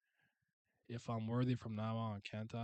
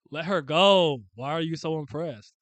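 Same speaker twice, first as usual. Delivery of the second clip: loud, raised-pitch confrontation; angry demand; 4 semitones higher, 14 dB louder than usual. Acted. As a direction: shout it at the captor. Confronting the captor while reading.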